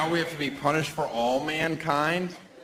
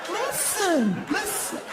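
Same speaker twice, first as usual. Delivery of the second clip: nagging woman mockery; slower than usual. Comic mocking a nagging woman, much higher.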